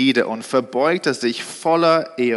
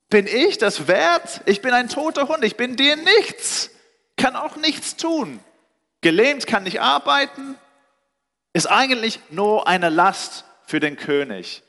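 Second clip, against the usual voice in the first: pleading disbelief; 9 semitones higher than usual. Retold or imitated. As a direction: plead up high like a man who feels worthless. Retold Mephibosheth's disbelief.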